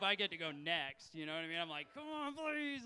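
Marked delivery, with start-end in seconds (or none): 1.9-2.8 high, whiny mock begging; whiny pleading. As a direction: beg like a needy puppy. Begging his girlfriend for a threesome.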